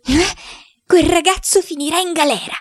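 high-pitched, irritated grumble; vexed, huffy high voice; peevish grumbling. A narrator's reading. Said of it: "borbottando tutta stizzita".

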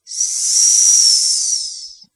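long, swelling hiss of air; breath-like yes. A narrator's reading. Boy as the spirit confirming presence, with a long hiss. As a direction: hiss a drawn-out ghostly assent.

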